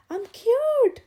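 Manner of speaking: high, loud, triumphant; girl shouting with relief once the spell is gone; joyful shout. Acted. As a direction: shout the good news. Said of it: "she shouted".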